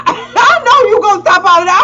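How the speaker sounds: ordinary delivery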